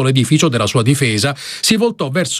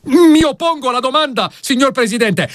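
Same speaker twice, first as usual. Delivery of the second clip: heated objection; 13 semitones higher than usual. Acted. Lawyer objects to the judge, high heated objection. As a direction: object with force.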